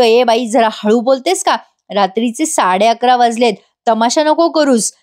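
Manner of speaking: ordinary delivery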